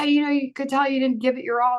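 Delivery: ordinary talk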